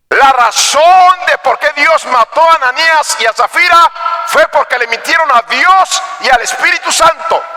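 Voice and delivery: high, insistent preaching; prédica enérgica. Real, not acted. Predica con voz elevada. (predicar con voz alta y enfática)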